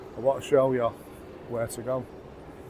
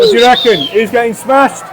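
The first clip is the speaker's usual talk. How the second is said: rowdy shout; 8 semitones higher, 9 dB louder than usual. Real, not acted. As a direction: rile up the mates. Drunk lads hyping each other.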